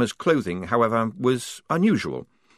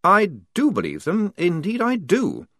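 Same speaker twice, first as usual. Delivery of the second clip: higher-pitched, mildly defiant insistence; nervous defiance; 9 semitones higher than usual. A narrator's reading. Lieutenant standing up to an enemy captain, nervous defiance. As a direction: stand your ground nervously. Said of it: "shaking with nervous defiance".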